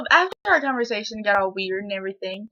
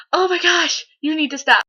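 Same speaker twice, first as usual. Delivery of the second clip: loud, higher-pitched fed-up protest; exasperated; 6 semitones higher, 6 dB louder than usual. Retold or imitated. Girl reacts to classmate grabbing her hair, exasperated. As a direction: tell him off in mock exasperation.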